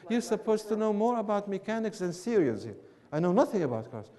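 usual speaking voice

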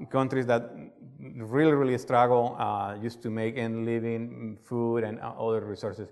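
ordinary talk, nothing marked

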